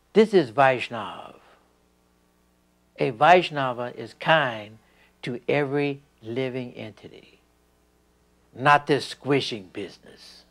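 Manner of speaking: slow, loud and emphatic; loud, slow quoted lesson; quoting the guru. Retold or imitated. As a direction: lay down the teaching firmly and slowly. Prabhupada's words quoted, emphatic.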